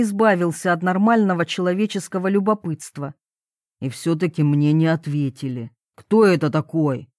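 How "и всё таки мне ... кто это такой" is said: low, gruff questioning; unfriendly. A narrator's reading. Papa asks about the lad, unfriendly. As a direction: ask coldly in a lowered voice. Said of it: "неласково спросил он".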